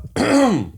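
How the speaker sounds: imitating a throat-clearing noise; short throat-clearing, done as an impression; imitated throat noise. Boyfriend imitates his girlfriend's throat noises, imitated throat clearing. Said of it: "these noises in her throat".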